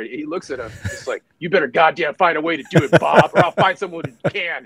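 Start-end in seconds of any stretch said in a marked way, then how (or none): 1.4-4.6 louder, higher voice laying down the law to a subordinate; angry colonel. Retold or imitated. Colonel threatening the logistics officer over breakfast, angry and loud. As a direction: demand it like a colonel who won't take no.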